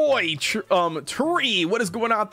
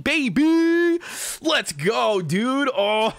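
triumphant cry; 2 semitones higher, much slower than usual. Real, not acted. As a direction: let the word soar up and hang. Celebrating the new cookie.

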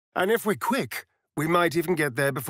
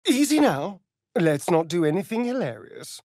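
ordinary delivery

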